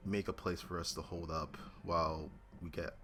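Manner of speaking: ordinary delivery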